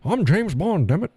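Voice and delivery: hammed-up Scottish accent as James Bond; mock James Bond. Joking to viewers as James Bond, Scottish accent. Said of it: "with a Scottish accent".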